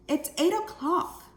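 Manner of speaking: urgent mom. Shown as a demonstration. Mom tells Jill the time, urgent. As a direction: hurry her along. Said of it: "where I change my voice".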